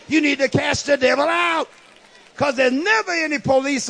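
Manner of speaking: high, driving sermon delivery; urgent pulpit exhortation; fired-up preaching. Real, not acted. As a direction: preach it hard, pushing through each phrase. Preaching at full force to congregation.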